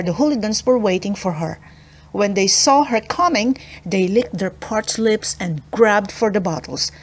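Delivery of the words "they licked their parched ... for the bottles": ordinary delivery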